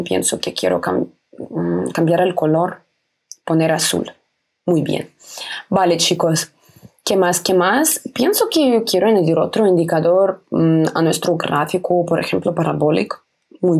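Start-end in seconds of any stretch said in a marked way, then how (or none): none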